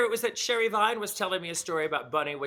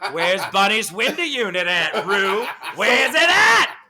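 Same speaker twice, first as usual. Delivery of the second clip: hammed-up drunken demand, building to a yell; mock demand; 9 dB louder, slower than usual. Imagining herself confronting Ru at Splash, building to a loud mock yell.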